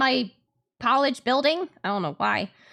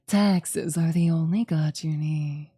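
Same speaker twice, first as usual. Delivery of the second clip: slow, low solemn decree; deadpan cult voice; 7 semitones lower, slower than usual. Pretend. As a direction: intone it like a prophet. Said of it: "Making me say weird shit".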